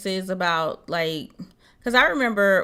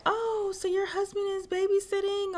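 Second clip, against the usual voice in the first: nosy onlooker; 11 semitones higher, 5 dB quieter than usual. Retold or imitated. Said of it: "People would be like".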